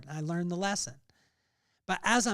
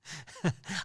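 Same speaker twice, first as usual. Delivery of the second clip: a quick real laugh before confessing; short chuckle. Real, not acted. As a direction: laugh lightly at your own point. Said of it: "I laugh because".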